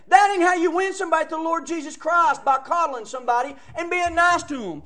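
loud, high fire-and-brimstone preaching; preaching at full force. Acted. Preacher warning his church to send visitors away, full force. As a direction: thunder it from the pulpit.

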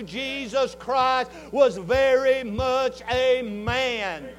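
slow, weighty sermon emphasis; emphatic preaching. Acted. Preacher to congregation, slow weighty preaching. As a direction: drive each word home.

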